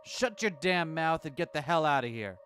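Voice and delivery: slow, hard command; angry order. Acted. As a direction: bite it out. Susumu orders him out.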